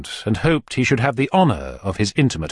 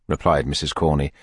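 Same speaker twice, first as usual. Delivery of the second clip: ordinary delivery, nothing marked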